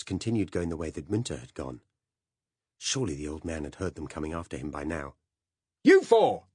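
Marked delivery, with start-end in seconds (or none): 5.8-6.5 loud, high, commanding bark; arrogant shout. Acted. Narrator ordering four policemen about, arrogant shout. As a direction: shout as if you outrank them. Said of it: "I shouted, with the sort of arrogance".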